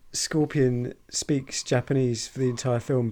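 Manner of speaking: ordinary talk, nothing marked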